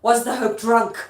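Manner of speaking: loud accusing question; Lady Macbeth performed with force; dramatic stage delivery. Acted. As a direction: throw it out as a theatrical challenge. Acts the line after scansion demo.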